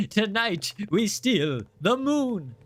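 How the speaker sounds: slow, drawn-out supervillain line in a lightly put-on voice; hint of a scheming cartoon villain; Minions villain quote. Streamer quoting a Minions line to chat, slow mock villain. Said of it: "Minions tonight, we said".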